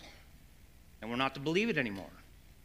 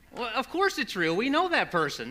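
high, louder insistence; mock protest; 9 semitones higher, 4 dB louder than usual. Parents answering their doubting daughter, mock indignant protest. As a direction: imitate someone sure they are right.

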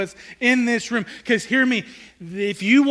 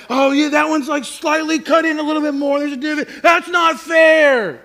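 put-on indignant kid; 6 semitones higher, 4 dB louder than usual. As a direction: complain like a cheated child, ending in a shout. Kids protesting an unequal cake cut.

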